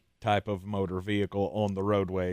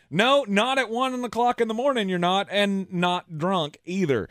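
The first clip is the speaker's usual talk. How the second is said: imitated officer; 8 semitones higher than usual. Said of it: "officer then reportedly replied to the man".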